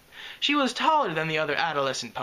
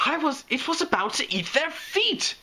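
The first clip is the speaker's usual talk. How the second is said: much higher hysterical screech; 12 semitones higher than usual. Acted. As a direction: screech it in panic. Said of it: "screeched the unicorn".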